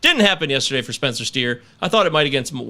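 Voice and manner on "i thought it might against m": ordinary talk, nothing marked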